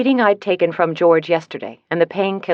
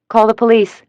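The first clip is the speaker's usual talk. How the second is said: a sudden yell of 'Call the police' into the phone; 5 semitones higher, 5 dB louder than usual. A narrator's reading. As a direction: shout it urgently for help. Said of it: "I yelled into the phone".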